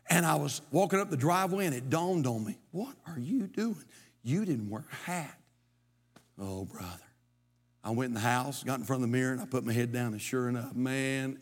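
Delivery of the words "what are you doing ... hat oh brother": hushed inner scolding. Retold or imitated. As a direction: quietly chide yourself.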